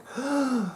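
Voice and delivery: high, sharp mock gasp of horror; shocked gasp. Mocking horrified viewers clutching their crosses, gasp. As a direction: gasp like a scandalized churchgoer.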